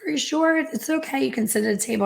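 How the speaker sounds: high, kindly hostess voice, pitched well up; surprised hostess. Retold or imitated. Hostess urging a lone diner to take a table, high and kind. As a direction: gently coax her toward a table, high and sweet. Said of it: "And she's like".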